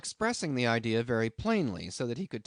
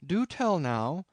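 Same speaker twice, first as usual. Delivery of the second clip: higher, slow, drawn-out exclamation; understated cry of disbelief; mild incredulous cry; 6 semitones higher, 4 dB louder, slower than usual. A narrator's reading. Captain challenges the narrator's claim, mildly incredulous cry. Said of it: "cried Bildad".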